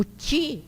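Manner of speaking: "ti" as chee; "ti" pronounced tchi; circumflex C sound. Shown as a demonstration. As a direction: say "ti" with a tch onset. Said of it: "é quando é o seco circunflexo".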